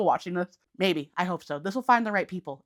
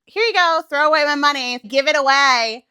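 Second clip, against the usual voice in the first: higher, louder flippant giveaway; mock careless spender; 6 semitones higher, 8 dB louder than usual. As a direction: toss the money away like it means nothing. Voicing careless money mindset.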